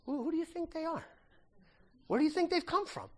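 lightly voiced quizzing angel; angel's question. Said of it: "he says".

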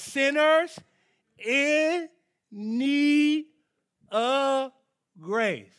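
loud, high, separated words dropping on the last. Real, not acted. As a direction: pause hard after each key word. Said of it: "I say it real slow".

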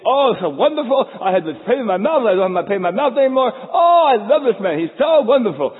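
hammed-up lovestruck sighs of relief; swooning patient. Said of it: "you go home and you think".

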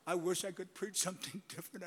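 ordinary delivery

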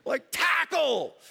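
drawn-out high shout of tackle; mock the fans by yelling it slowly. Pastor mocking fans yelling on defense, slow loud shout. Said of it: "what else are they doing".

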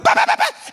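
very high rapid bahs; high mock gunfire; gun noise. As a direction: fire it off again. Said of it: "continual pattern of".

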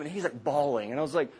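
usual speaking voice

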